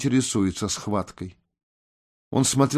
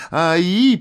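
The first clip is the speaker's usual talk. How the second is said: high, loud yelp; sharp yelp; 8 semitones higher, 6 dB louder than usual. Acted. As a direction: cry out suddenly mid-fight. Cry of effort or pain in fight. (резкий высокий вскрик)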